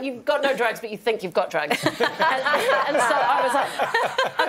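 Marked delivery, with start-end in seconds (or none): none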